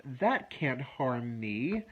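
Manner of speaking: each word slow and firm; slow, deliberate affirmation. Shown as a demonstration. Coach shows clients the calming phrase, slow and deliberate. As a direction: say it slowly so it sinks in. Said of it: "I would say".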